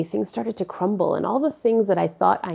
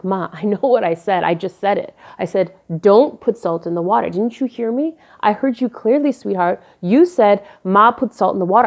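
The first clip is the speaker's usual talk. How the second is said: louder, insistent shouting back; heated argument; 6 dB louder than usual. Retold or imitated. Daughter and mom screaming over the salt, loud and heated. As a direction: raise your voice and dig in.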